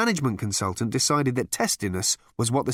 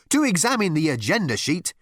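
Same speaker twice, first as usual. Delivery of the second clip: higher, strident correction; 7 semitones higher than usual. A narrator's reading. Girl correcting Ford, strident. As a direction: point to procedure stridently. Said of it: "said the girl with a strident voice".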